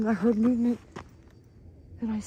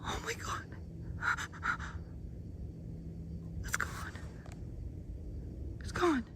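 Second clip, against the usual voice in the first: very soft breathless whisper; barely audible frightened whisper; terrified whisper; 6 semitones higher, 12 dB quieter than usual. Real, not acted. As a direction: whisper in shock. Creature just vanished.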